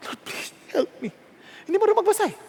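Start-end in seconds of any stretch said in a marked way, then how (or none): 0.1-1.1 soft whispered plea; kneeling maid whispering to the Lord; whispered prayer. Retold or imitated. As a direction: breathe the prayer on your knees.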